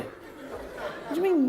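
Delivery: very high, drawn-out mock disbelief; indignant dog owner. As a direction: squeal it slowly, affronted by the refusal. Owner argues with reluctant dog.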